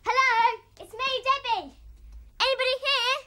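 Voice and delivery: loud, high hello into the void; boy calling into an empty place, loud and high. Acted. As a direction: shout it into an empty room.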